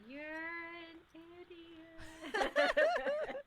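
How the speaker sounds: laughing, loud on idiot; laughing insult. Acted. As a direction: blurt it out laughing. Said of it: "I go".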